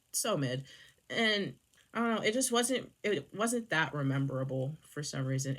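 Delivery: ordinary talk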